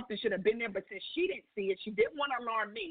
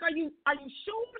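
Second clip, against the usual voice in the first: high, stammered disbelief, retold; higher-pitched doubting question; shocked; 7 semitones higher than usual. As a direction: act out being too stunned to believe it. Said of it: "I was in such shock".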